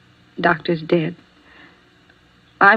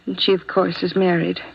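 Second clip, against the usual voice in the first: usual speaking voice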